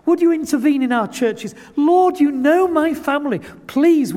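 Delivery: high, loud, begging cry to God; earnest pleading prayer. Shown as a demonstration. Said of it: "earnest crying out to God and saying".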